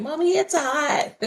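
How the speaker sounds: put-on high, slow little-kid voice; imitating her kid. Mother imitating her child complaining about heat, high slow kid voice. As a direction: whine it like a small child. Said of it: "My kids don't know how to have this accent".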